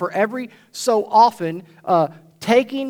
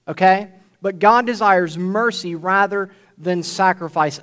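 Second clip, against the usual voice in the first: normal talking voice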